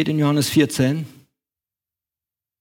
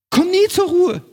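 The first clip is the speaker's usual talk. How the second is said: high, louder exclamation; exasperated cry; 12 semitones higher, 4 dB louder than usual. Acted. Preacher voicing the church's restless pressure, exasperated cry. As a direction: burst out with the restlessness.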